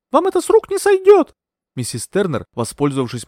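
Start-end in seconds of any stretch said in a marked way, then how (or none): 0.1-1.3 much higher, louder furious outburst; blurted out. A narrator's reading. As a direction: spit it at the villain. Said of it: "выпалила я". (гневно выпалила)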